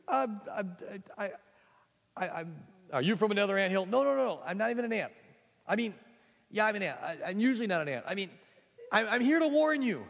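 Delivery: high, loud stammering; flustered boy. Acted. Boy stammers to the guard ant, high and flustered. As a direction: trip over every word in panic.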